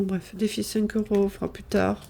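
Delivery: ordinary talk, nothing marked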